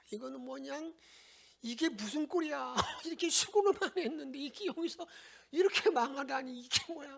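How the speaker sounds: high-pitched mock lament; lamenting crowd. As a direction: wail it like a crowd gone to ruin. Preacher voices lamenting Israelites.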